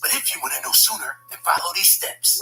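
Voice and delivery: secretive unvoiced whisper. Kid reads a mystery letter in a secretive whisper. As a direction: breathe it like a secret.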